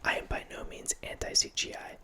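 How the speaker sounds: ordinary delivery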